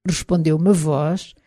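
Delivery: plain reading voice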